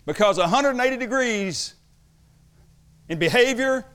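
high, loud, slow pulpit delivery; forceful, deliberate sermon proclamation; emphatic preaching. Real, not acted. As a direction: hammer each phrase out like a preacher, with pauses. Preaches on the word if.

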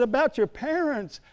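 high, slow, eager calling of her name; high, drawn-out excited call. Retold or imitated. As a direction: burst in thrilled to share news. Sharing the good news.

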